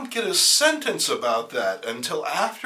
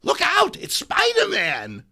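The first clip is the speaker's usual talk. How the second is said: high, loud cry of alarm; alarmed shout; 8 dB louder than usual. Acted. Gladys warning the others, shouting. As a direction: yell it out in a panic.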